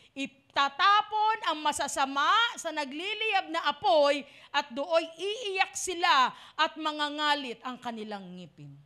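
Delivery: solemn Bible reading, slowing at the end; solemn scripture read in a sermon. Acted. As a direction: read the verse gravely, dropping low at the close.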